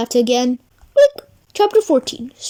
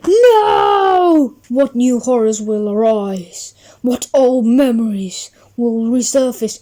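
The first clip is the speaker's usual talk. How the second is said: deep ominous teaser; spooky sequel tease; dramatic trailer voice; 4 semitones lower than usual. Acted. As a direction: read it like a movie trailer.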